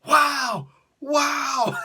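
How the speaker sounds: put-on a boy awed by the fight news; amazed kid. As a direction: gasp like a thrilled kid. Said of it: "and I'm like".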